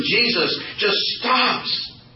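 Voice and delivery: slow, high "Jesus just stops"; dramatic slowdown. Acted. Preacher building to Jesus stopping, slow and awed. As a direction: draw it out with awe. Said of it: "dramatic stop".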